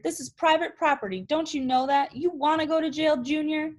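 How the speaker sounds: put-on furious foreman catching a boy at the fence; hollering foreman. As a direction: holler at the trespassing kid. Foreman keeps yelling at Roy.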